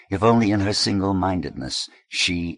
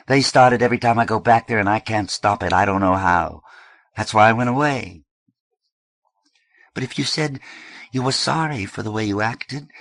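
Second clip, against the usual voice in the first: louder, fast and bitter; angry outburst; 6 semitones higher, 6 dB louder than usual. Acted. Eddie to his sister, raised in bitter anger. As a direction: throw the apologies back at her.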